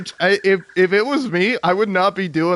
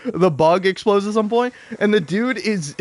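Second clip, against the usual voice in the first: ordinary talk, nothing marked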